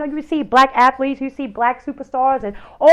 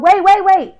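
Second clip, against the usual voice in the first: higher, louder urgent call; 7 semitones higher, 5 dB louder than usual. Retold or imitated. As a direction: stop him before he leaves. Mother calling her son back.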